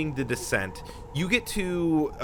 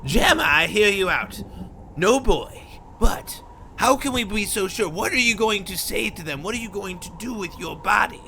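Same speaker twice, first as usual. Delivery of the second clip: high-pitched character voice; Migash; 6 semitones higher than usual. Acted. Migash doubting Jammer's seduction plan, high-pitched and urging. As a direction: pitch it right up, questioning and insistent. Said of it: "Migash goes".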